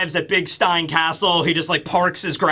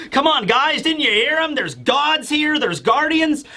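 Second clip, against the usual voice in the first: high, excited imitation of a young hero; acted thrill at coming adventure; eager adventurer; 7 semitones higher than usual. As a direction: urge the crew on, pitched up. Luffy voiced, thrilled by adventure.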